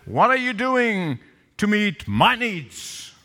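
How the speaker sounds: slow, drawn-out demand with big pitch swings; mock a self-centred spouse, stretching the words. Spouse confronting the other about unmet needs, demanding. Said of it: "They look at each other and said".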